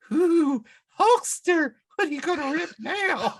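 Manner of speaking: high, loud teasing challenge; mock taunt. Taunting the Hulkster.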